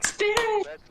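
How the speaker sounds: Spirit! called out high and bright with joy; excited call. Real, not acted. Teammate cheering the correct ghost guess, excited call. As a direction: cheer the guess being right.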